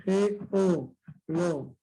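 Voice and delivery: G, O, lo as isolated beats; split into syllables. Shown as a demonstration. Teacher repeats geología's split, syllable by syllable. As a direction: leave a gap after each syllable. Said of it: "Cómo sería en el caso de la palabra geología".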